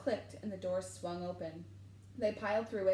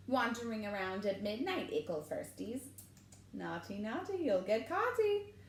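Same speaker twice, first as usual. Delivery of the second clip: cackling taunt; 2 semitones higher than usual. A narrator's reading. Peeves catching students out at midnight, cackling taunt. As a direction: taunt them like a gleeful imp. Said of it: "Peeves cackled".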